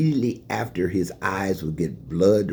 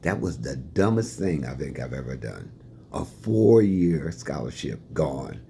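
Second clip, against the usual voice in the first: ordinary talk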